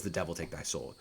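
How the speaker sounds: offhand recalled curse; quiet recap. Retold or imitated.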